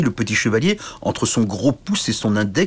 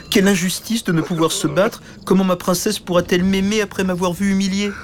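higher, mild whining lament; tearful little knight; 11 semitones higher than usual. Acted. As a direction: moan over your humiliation. Petit chevalier pleure. (petit chevalier plaintif, voix aiguë)

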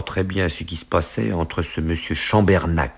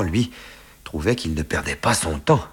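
ordinary delivery

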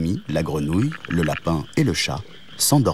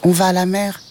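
much higher cat voice; 11 semitones higher than usual. Acted. Cat explaining to the mantis they're blocked, high voice. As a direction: answer as a little cat. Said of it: "répondit le chat".

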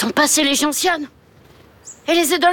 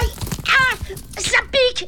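yelp of pain; 9 semitones higher than usual. Acted. Piqué par le hérisson.

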